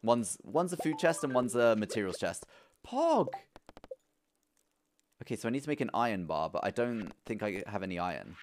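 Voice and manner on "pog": high-pitched exclamation; one high burst of delight; excited cheer. Real, not acted. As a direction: cheer it out in triumph. Celebrating in the game.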